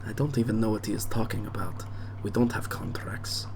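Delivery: quick, whispered denial; hushed aside. Retold or imitated. Daughter quietly disowning her father's deal, whispering. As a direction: whisper it fast behind his back. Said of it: "she goes".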